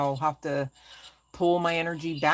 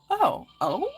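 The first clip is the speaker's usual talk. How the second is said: retold realization; 12 semitones higher, 5 dB louder, much slower than usual. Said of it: "And I go".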